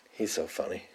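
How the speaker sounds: usual speaking voice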